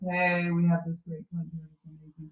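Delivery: low, flat, unexcited pitch with no lift. Shown as a demonstration. Coach showing a team member a dull pitch, low and flat. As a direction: say it low and flat, like it's no big deal. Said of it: "Two totally different, um, tones".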